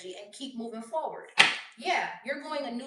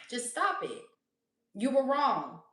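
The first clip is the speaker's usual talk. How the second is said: ordinary talk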